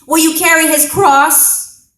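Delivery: very loud, high, full-force shout; Roman soldier's scream. Acted. Roman soldier to a bystander at the crucifixion, screaming. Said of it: "the Roman soldier screams in my face".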